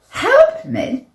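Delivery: very high, loud echoing question; incredulous. A narrator's reading. Stuck player answering the helpers, incredulous. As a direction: throw it back in disbelief. Disbelieves the offer of help.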